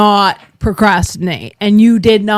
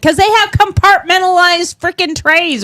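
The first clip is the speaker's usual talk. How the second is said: exasperated; 7 semitones higher than usual. Retold or imitated. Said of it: "I'm like".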